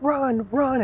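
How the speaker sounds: high mock yell urging a runner on; excited high-pitched imitation of screaming; retold father's scream. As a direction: mimic a frantic dad's yell at restrained volume. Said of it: "And he is screaming and jumping".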